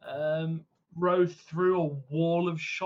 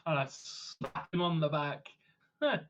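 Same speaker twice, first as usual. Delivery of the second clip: usual speaking voice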